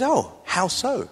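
slow, drawn-out "Oh, how so?" in an Oxfordshire way; Oxfordshire phrasing. Retold or imitated. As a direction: ask it the way they talk in Oxfordshire. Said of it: "that's how we speak in Oxfordshire".

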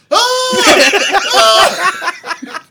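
high laughing fake screams; imitating a man screaming bloody murder; mock screams. Friend mimics Shane's night screaming, laughing mock scream. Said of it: "Like he was being murdered".

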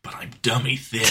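low, whispered confession; hushed stealth-soldier voice, close to laughing; gruff action-hero parody. Acted. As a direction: rasp it quietly under your breath. Solid Snake meme parody.

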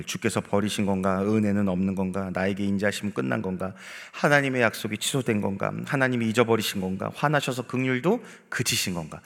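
usual speaking voice

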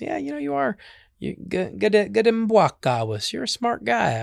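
high-pitched, admiring praise; admiring trickster. Acted. Trickster telling the porcupine he is clever, admiring. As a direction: pitch it up, full of approval.

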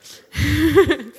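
loud, high laugh at herself. Real, not acted. Preacher laughing at her Satan joke with the congregation. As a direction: laugh at your own dad joke.

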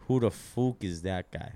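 put-on slow Irish accent at normal pitch; Conor McGregor. Trying out a fighter's catchphrase in a slow Irish accent. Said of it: "Conor McGregor".

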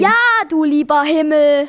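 mildly irritated woman; annoyed. A narrator's reading. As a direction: huff it out, slightly annoyed. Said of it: "sagte die Frau etwas genervt".

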